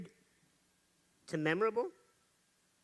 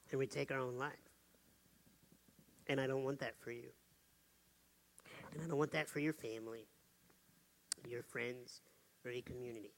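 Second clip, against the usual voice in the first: low, soft, somber plea; hushed and grave; 9 semitones lower, 12 dB quieter than usual. Real, not acted. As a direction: drop to a hush for the serious part. Somber suicide appeal.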